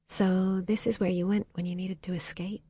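soft hushed question; whispering. A narrator's reading. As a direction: hush it so the peace stays. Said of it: "Holly whispered".

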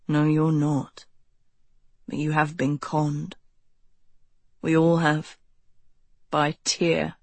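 low, persuasive male voice; low cult leader voice. A narrator's reading. Ro voiced in the story.

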